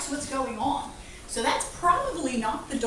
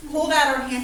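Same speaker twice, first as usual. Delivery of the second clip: usual speaking voice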